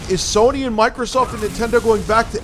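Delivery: high, loud, exasperated shouting; heated yelling. Real, not acted. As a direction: tear into him, full volume. Heated argument, yelling at friend.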